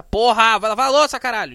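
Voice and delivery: loud exasperated yelling; shouted nagging. Retold or imitated. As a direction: bellow the nagging at full volume. Nagging builds to yell.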